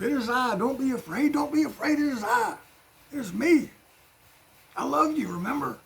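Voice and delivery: calm the frightened friends gently; tender reassurance. Retold or imitated. Jesus calming his scared disciples, high tender reassurance. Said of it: "Jesus identifies himself".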